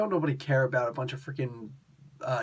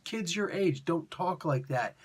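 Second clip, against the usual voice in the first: ordinary talk, nothing marked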